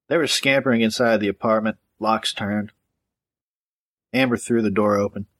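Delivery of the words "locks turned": ordinary delivery, nothing marked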